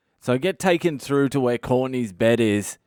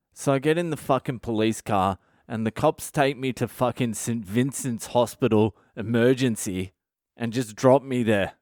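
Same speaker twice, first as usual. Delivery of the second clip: usual speaking voice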